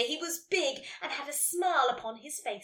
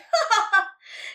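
loud, high acted laugh; sudden loud burst of laughter; 8 semitones higher, 11 dB louder than usual. A narrator's reading. Friend bursting out laughing at Nelly. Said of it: "Karen burst out laughing".

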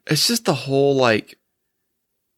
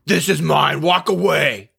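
tough guy threat; 5 semitones higher, slower than usual. Pretend. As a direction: stake your claim like an armed guard. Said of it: "be like".

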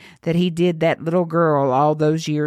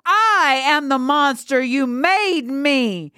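high, drawn-out accusing cry; furious screech; 10 semitones higher, slower than usual. Acted. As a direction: screech it in rage, stretching the last words. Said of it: "As the girl screeched".

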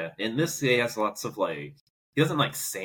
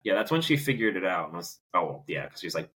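ordinary talk, nothing marked

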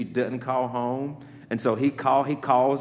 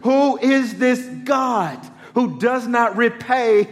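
rising into full-force preaching, louder and higher; building preaching; 10 semitones higher, 8 dB louder than usual. Acted. Preacher tells congregation of the crucifixion, building preaching.

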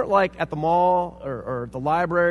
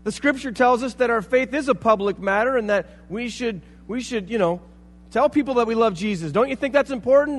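heated reply; 7 semitones higher, 7 dB louder than usual. Retold or imitated. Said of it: "I looked at him and I'm like".

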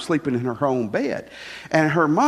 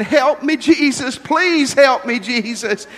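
high, loud pleading prayer, slow on please; crying child's plea; 8 semitones higher, 4 dB louder, slower than usual. Retold or imitated. Child crying in bed, begging Jesus for help, high and loud. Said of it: "she's crying and she's saying".

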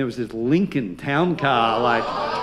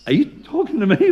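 mock surprise; 9 semitones higher, 5 dB louder, faster than usual. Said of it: "I'm like".

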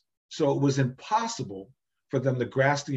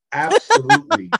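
laughing; 14 semitones higher, 10 dB louder than usual. Real, not acted. Laughing emphatic agreement.

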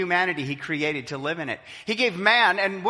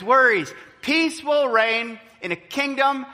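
preaching fervour; 3 semitones higher than usual. Acted. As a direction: build each no more toward the amen. Preaching climax, building list.

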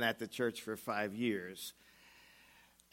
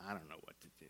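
soft, low, at a loss; trailing off helplessly; quiet helpless; 5 semitones lower, 13 dB quieter, much faster than usual. Acted. Elder ending his story, quiet and lost.

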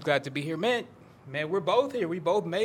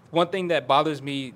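normal talking voice

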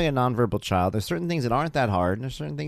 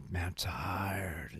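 exhausted drawl, stretching "tired" very long; really tired; 4 semitones lower, 11 dB quieter, much slower than usual. Shown as a demonstration. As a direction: drag out the word "tired", low-energy and soft. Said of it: "if you're really tired".

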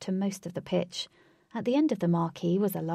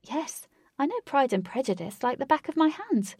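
eager reply, pitched higher; enthusiastic; 6 semitones higher than usual. A narrator's reading. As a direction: answer keenly, proud to know it. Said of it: "Katie replied enthusiastically".

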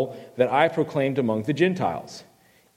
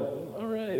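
a hushed little cheer, much softer; quiet cheer; 5 semitones higher, 11 dB quieter than usual. Retold or imitated. Tar Heel fan hiding in Clemson section, quiet cheer. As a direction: cheer under your breath so nobody hears. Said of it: "put my hand down quietly".

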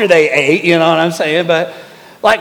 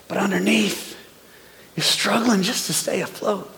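hushed and intimate; 7 dB quieter than usual. Real, not acted. Hidden struggle beneath the surface.

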